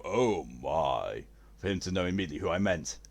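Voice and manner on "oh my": low, deep "oh my"; imitated low-pitched exclamation; catchphrase imitation. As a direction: drop it low for a recognisable catchphrase. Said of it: "get on the voice chat and go".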